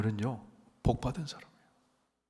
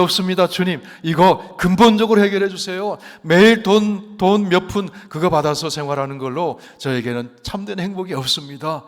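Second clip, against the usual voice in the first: blind beggar's plea; 4 semitones higher, 8 dB louder than usual. Acted. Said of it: "자기 소원을 분명하게 말했어요".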